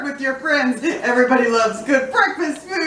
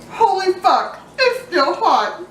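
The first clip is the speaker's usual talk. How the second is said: surprised; 7 semitones higher than usual. Real, not acted. Burns mouth on hot food.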